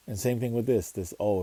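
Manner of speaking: ordinary talk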